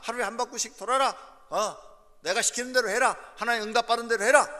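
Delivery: loud, high-pitched orders to the troops; commanding leader. Acted. Joshua orders priests to march round Jericho, forceful command.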